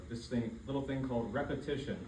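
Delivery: normal talking voice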